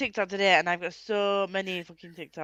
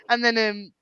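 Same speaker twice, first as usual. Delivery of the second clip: ordinary talk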